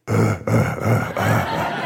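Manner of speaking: very low, soft pulsed grunts; imitated grunts. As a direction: grunt deep in the throat, wordlessly. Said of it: "He would grunt".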